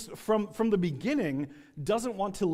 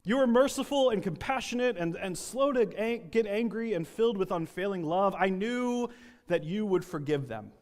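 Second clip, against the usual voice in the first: screaming at God; 3 semitones higher than usual. Acted. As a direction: rage at God, full volume. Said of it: "I like to think that he's screaming at God".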